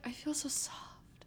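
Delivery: soft breathy whisper, slow. Retold or imitated. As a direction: whisper it dreamily. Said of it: "I was literally like".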